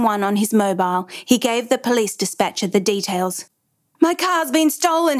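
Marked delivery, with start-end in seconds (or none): 4.0-5.2 higher-pitched, agitated report; restrained narrated yell, pitched up; urgent yell. A narrator's reading. Father reports the theft to police dispatcher, yelling. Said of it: "he yelled".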